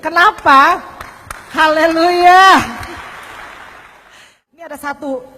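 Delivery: ordinary talk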